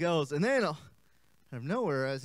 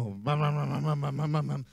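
low humming motor sound; lawnmower hum; 3 semitones lower than usual. Retold or imitated. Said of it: "Whatever mowing sounds".

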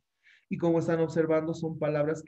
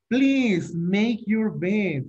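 very slow and higher, each word laid out for the class; slow model sentence; 5 semitones higher, 5 dB louder, much slower than usual. Shown as a demonstration. Teacher models for students, slow and raised. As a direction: spell it out slowly for learners.

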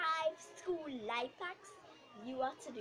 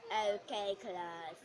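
lower, dull teacher voice; boring teacher; 4 semitones lower, 4 dB quieter than usual. Acted. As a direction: drone at the class like a bored teacher. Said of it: "The boring teacher".

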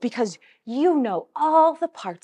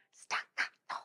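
whispered staccato, short and crisp; hushed whispered staccato; 16 dB quieter than usual. Shown as a demonstration. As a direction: whisper it to show detached. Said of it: "short and detached".

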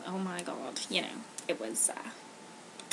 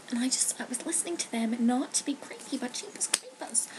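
whispered aside while eavesdropping; 6 dB quieter than usual. Real, not acted. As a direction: drop to a sneaky whisper. Describes eavesdropping on two girls.

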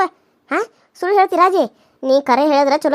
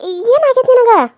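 loud, high, slow scolding; mother yelling at her daughter; angry shout; 5 semitones higher, 5 dB louder, slower than usual. Acted. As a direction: bark it at her, drawn out.

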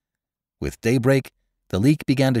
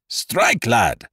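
bellowing command. A narrator's reading. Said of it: "he cried out in a bellowing voice".

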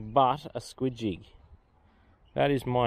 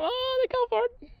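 excited squeal. Real, not acted. Fish striking the bait.

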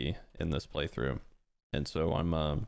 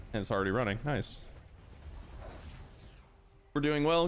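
normal talking voice

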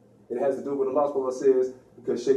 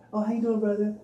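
higher, sugary mock greeting; a hint of a flirty sweet voice; sweetened voice; 6 semitones higher than usual. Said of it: "they don't sweeten their voices".

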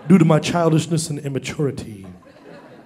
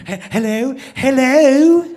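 mock British phone answer, rising; high, puzzled British "hello"; British accent; 6 semitones higher, much slower than usual. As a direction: imitate a confused Brit on the phone. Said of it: "British, I knew it".

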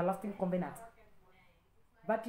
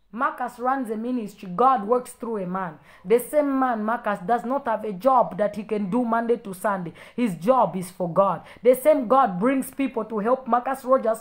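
loud, driving sermon; forceful preaching; 8 dB louder than usual. Real, not acted. Telling critics God works through the minister, forceful preaching. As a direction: preach it out with full volume.

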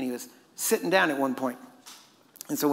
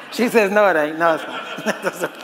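louder, talking through laughter; laughing; 4 semitones higher, 5 dB louder, slower than usual. Real, not acted. Preacher relays a listener's retort about the water, laughing. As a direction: relay the retort while cracking up.

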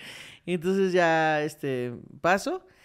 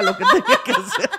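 laughing mock plea; 14 semitones higher, 7 dB louder than usual. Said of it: "Usted haga lo que tenga que hacer".

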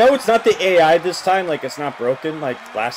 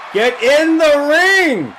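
loud, much higher, slow stretched yells; drawn-out shouting; 12 semitones higher, 7 dB louder, much slower than usual. Real, not acted. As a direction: hold each word out in desperate command. Yelling at wrestler again.

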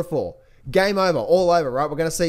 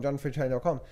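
ordinary talk, nothing marked

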